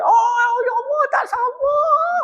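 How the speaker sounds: high, slow, gushing delight; excited joy. Shown as a demonstration. Lecturer demonstrating happiness when standing, mock excited. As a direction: squeal like you can't wait. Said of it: "kalau kita gembira".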